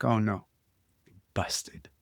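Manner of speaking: whispered to himself; inner thought. Retold or imitated. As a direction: think it under your breath, caught out. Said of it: "And I think".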